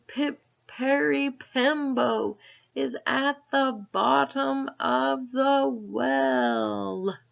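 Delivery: put-on very slow, high and loud boy's call; very slowly. A narrator's reading. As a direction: spell out every syllable, slowly. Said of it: "and slowly, very slowly said".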